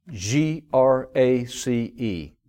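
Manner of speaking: slow letter by letter, then slow phrase; spelled out slowly. Shown as a demonstration. Preacher spelling grace for the congregation, slow. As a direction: spell G R A C E deliberately. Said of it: "spelling out an acronym".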